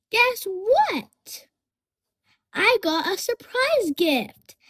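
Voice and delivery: high, bright-voiced excitement over a gift; kids' show host squealing about a present; excited. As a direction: act thrilled about a surprise. Teacher acts getting a gift.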